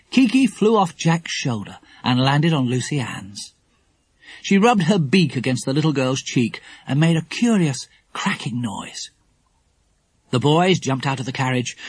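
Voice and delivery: ordinary delivery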